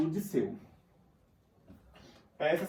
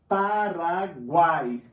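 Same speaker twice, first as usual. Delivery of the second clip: "Paraguai" alto e bem lento, silabado; sílaba final inteira; 7 dB louder, much slower than usual. Shown as a demonstration. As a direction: silabe "Paraguai" mantendo "guai" numa só sílaba. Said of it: "não separo". ("Paraguai" pausado, com "guai" junto)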